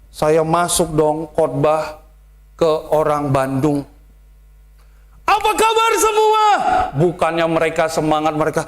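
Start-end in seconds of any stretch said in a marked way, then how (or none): 5.3-6.4 high, loud Betawi-style shout; rough loud greeting. Shown as a demonstration. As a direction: blast the greeting at the crowd. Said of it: "pendetanya kasar". (sapaan keras ala Betawi)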